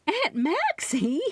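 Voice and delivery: startled cry, high and slow; a scandalized gasp of her name; gasping out her aunt's name in shock. A narrator's reading. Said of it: "I gasped".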